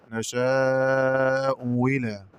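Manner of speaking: نشاء إلى recited as "nashaa-u wila"; slow recital of نشاء إلى, w replacing the second hamza; hamza turned waw. Shown as a demonstration. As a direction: draw out نشاء, then say إلى with a pure w. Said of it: "أو إبدالها واوا خالصة مكسورة".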